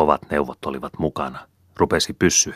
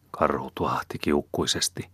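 ordinary delivery

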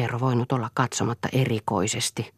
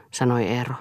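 plain reading voice

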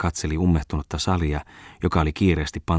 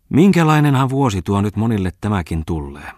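pressed hard, louder and higher; emphatic; 4 semitones higher, 6 dB louder than usual. A narrator's reading. Farmwife presses her point to the merchant, emphatic and loud. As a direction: lean on every word. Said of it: "jatkoi hän yhä korostaen".

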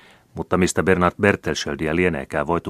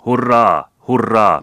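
much higher, louder hurrahs; crowd cheering; 6 semitones higher, 10 dB louder, slower than usual. A narrator's reading. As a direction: roar it out. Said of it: "melusi mielensä muuttanut väkijoukko".

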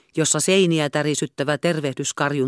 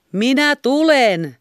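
slow, high, loud call; shout; 10 semitones higher, 6 dB louder, slower than usual. A narrator's reading. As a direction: call out through the door. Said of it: "huusi hän".

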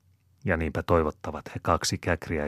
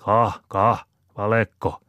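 higher, louder teasing repetition; repeating; 5 semitones higher, 6 dB louder, slower than usual. Acted. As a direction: chant it back at him mockingly. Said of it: "hokee Käkriäinen".

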